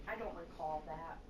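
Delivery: low, slow, soft denial; feigned innocence. Retold or imitated. Husband playing dumb to his scolding wife, feigned innocence. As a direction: play dumb, dropping low and unhurried.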